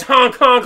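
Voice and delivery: loud honking; mock clown honk. Mocking the clown, loud honks.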